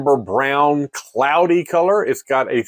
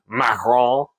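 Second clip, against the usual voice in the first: mock French; 3 semitones lower than usual. Shown as a demonstration. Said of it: "more emphasis".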